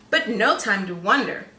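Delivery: plain reading voice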